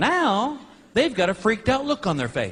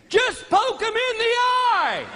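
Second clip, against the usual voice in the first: retold scream; 13 semitones higher than usual. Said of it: "screams at me".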